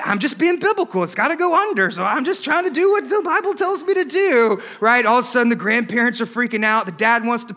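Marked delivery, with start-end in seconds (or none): 0.0-4.6 high, whiny self-justifying plea; defensive protest. Acted. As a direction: plead innocence in a higher voice. Pastor defends himself to angry mother.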